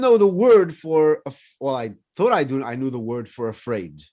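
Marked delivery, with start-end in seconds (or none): none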